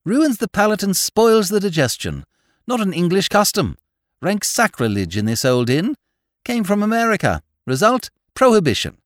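high, loud, indignant declaiming; emphatic. A narrator's reading. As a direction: pronounce judgement with great force. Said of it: "said Whimsy emphatically".

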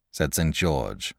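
ordinary delivery, nothing marked

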